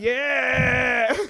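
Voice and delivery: high excited shout; cheering crowd. Retold or imitated. Crowd cheering, acted quote.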